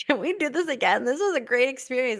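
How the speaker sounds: playful eager plea. Retold or imitated. Asking husband, playfully eager. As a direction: beg it playfully.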